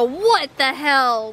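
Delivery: slow, drawn-out outrage with a swoop; indignant disbelief. Acted. Voicing viewers' reaction to the mess, indignant disbelief. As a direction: stretch each word in disbelief. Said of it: "you're all gonna go".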